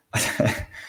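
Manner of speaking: soft unvoiced chuckle; a little fond laugh to himself; kind gentle laugh. Real, not acted. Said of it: "I'm just laughing".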